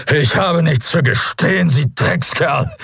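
high, strained angry refusal; defiant outburst. A narrator's reading. Prisoner defies his interrogator.

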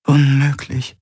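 ordinary delivery, nothing marked

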